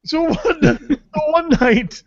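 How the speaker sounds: words breaking into laughter; laughing. Real, not acted. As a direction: still laughing as he tries to start the story. Laughing through the restart.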